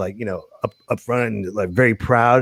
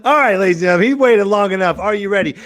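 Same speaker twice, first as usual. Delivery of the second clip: show announcer; 10 semitones higher than usual. Acted. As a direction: build excitement to a stretched, shouted name. MC announces the guest.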